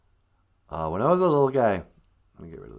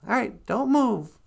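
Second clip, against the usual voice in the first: very high, obliging put-on voice; sweetie character; 11 semitones higher than usual. Sweetie preparing to teleport someone, high chirpy voice. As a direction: agree eagerly in a squeaky high pitch.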